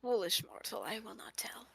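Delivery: hushed villain whisper. Pretend. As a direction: whisper it like a theatrical demon. Mock dramatic villain reply.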